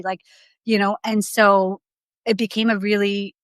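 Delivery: normal talking voice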